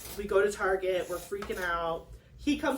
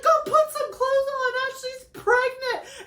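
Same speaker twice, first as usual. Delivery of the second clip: much higher, louder shrieking through laughter and tears; laughing mom shriek; 14 semitones higher, 4 dB louder than usual. Retold or imitated. Mom yelling at naked husband, laughing shriek. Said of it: "she's like".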